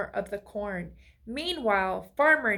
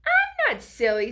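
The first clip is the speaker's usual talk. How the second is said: high, loud indignant protest; 10 semitones higher, 6 dB louder than usual. A narrator's reading. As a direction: insist hurt and cross. Scarecrow protests crows.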